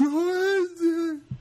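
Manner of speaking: laughing. Real, not acted. Laugh after the imitation.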